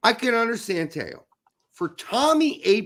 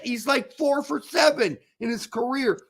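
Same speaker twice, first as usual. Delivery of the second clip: ordinary talk, nothing marked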